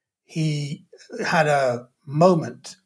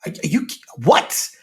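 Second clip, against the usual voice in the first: thrown, wobbling disbelief; slower than usual. Retold or imitated. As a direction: stammer out broken, baffled whats. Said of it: "he had this real wobble".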